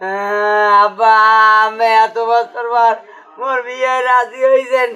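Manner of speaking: slow, drawn-out tearful wail; crying. Acted. Beaten son complaining to his father about the match, crying. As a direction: sob the complaint out in long whines. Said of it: "ভাই ওই কান্দে না".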